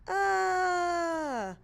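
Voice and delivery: high, loud mock scream of a falling skier. Teacher voicing the skier falling off the mountain, loud scream. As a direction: yell it like you've just gone off a cliff. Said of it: "he's gonna holler".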